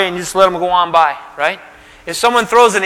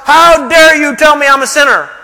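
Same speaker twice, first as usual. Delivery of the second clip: mock indignant outburst; 7 semitones higher, 6 dB louder than usual. Voicing defensive outburst.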